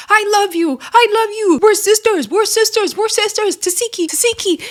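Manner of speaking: high-pitched, repetitive mock begging; mock pleading. [name] pleading with her sister, high-pitched mock begging. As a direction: imitate someone desperately pleading. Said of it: "[name] is pleading with her sister".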